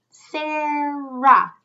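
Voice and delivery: name stretched into two long syllables. Shown as a demonstration. Teacher sounding out her name for children, drawn out. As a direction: draw out both syllables of Sarah. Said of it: "How many times does my mouth open when I say".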